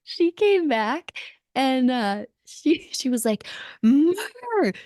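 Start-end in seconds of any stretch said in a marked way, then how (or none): 3.8-4.7 high, wordless angry growl-yell; mock angry yell. Snowboarder mimics her teammate storming back, mock yell. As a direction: imitate a friend yelling in a wordless roar. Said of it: "just yelling at me".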